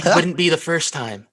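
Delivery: high, hammed-up girl voice for Ahsoka; man doing a woman's voice. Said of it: "Oh my goodness".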